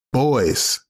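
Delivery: ordinary talk, nothing marked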